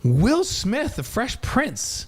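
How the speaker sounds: high, drawn-out amazed exclamation; delighted surprise. Real, not acted. Host reacting to guest's favorite singer, delighted surprise. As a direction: repeat the pick in amazed delight, pitch soaring.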